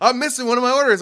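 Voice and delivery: high-pitched imitation of an upset customer; mock complaint. Customer telling the driver food is missing, mock dismay.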